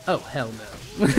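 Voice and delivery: dropped low and soft, hell no; low disbelief. Retold or imitated. Watching the truck drive off, a low soft oh hell no. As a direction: mutter it under your breath in disbelief.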